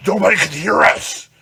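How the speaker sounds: a loud stage whisper that carries; magnified loud shush. Retold or imitated. Drunk friend shushing loudly in the hotel room. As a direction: shush so hard it booms. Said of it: "you try to do the shh ... but it's, like, magnified".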